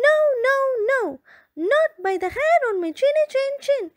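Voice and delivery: high, fast panicky rabbit; squeaky fast pleading; frightened rabbit cry. Acted. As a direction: blurt it out like a scared bunny. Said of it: "cried the rabbit".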